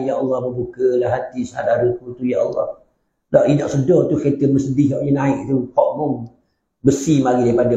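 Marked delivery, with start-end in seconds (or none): 0.0-2.8 pious man's prayer. Retold or imitated. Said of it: "Doa orang alim".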